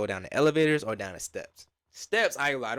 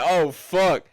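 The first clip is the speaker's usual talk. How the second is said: loud, higher-pitched retold alarm; alarmed excitement; 4 semitones higher, 9 dB louder than usual. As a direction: blurt it out, half-panicked storyteller. Retells his alarm as it gets real.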